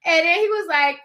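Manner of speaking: ordinary delivery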